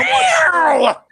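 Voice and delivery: high, loud, put-on wow; shrill mock cry of wow; mock shout. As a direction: yell it to interrupt the reader. Mocking shout during reading.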